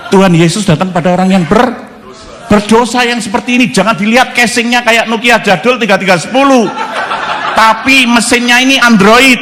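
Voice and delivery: rousing preaching. Real, not acted. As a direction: lift the room up to a shout of praise.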